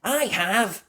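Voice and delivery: high, eager answer; eager cry. Acted. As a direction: jump in eagerly. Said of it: "cried Mandy".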